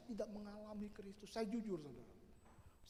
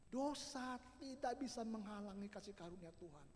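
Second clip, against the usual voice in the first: usual speaking voice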